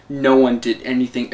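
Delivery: ordinary delivery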